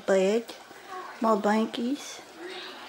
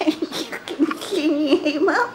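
laughing, high and slow; nervous laugh; 5 semitones higher, slower than usual. Acted. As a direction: giggle nervously at being found. Caught hiding by mom.

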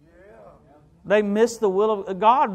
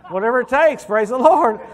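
excited praise; 4 semitones higher, 8 dB louder than usual. Real, not acted. Preacher exclaiming praise.

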